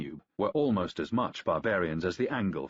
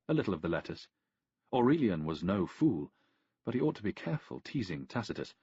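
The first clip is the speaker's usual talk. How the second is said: ordinary delivery, nothing marked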